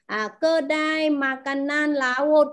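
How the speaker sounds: kedai makanan laut drawn out slowly; slow model phrase. Shown as a demonstration. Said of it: "có nghĩa là". (đọc chậm kedai makanan laut cho học trò)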